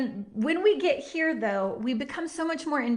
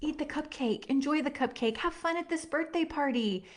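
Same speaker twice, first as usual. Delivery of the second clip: tender reassurance; 3 semitones higher than usual. Retold or imitated. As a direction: soothe her like your little girl. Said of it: "cup her hand and be like".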